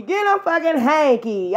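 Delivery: ordinary delivery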